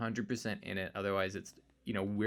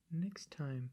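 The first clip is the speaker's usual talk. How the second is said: hushed, eerie whisper; spooky whisper; 6 semitones higher, 5 dB quieter than usual. Acted. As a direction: whisper it like a ghost. Spooky sign-off to listeners.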